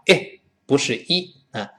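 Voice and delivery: normal talking voice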